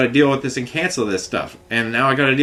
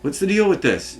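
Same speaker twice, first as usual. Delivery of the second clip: higher, baffled demand; pitched-up what-gives; exasperated question; 6 semitones higher than usual. Retold or imitated. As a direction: toss it up in disbelief. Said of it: "so I'm like".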